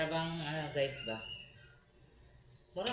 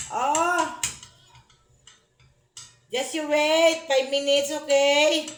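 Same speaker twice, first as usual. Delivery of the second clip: raised, high-pitched caretaker's call; 13 semitones higher, 7 dB louder, slower than usual. Real, not acted. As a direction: call out loudly to hush a crying little one. Soothing crying child from afar.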